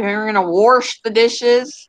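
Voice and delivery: "wash" said as "warsh", drawn out; slow mimicry with an R in "wash"; intrusive R. Quoting local people about dishes, "wash" as "warsh". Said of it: "where do you see the R in that word".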